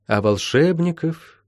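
mildly rueful, drawn-out words; sighing. A narrator's reading. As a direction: draw it out with a sigh. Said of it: "целительница вздохнула".

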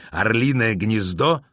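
slow, incredulous repeat of the name; alarmed demand. A narrator's reading. Boris grabbing the officer by the collar, slow alarmed demand. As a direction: draw out the question in mounting alarm.

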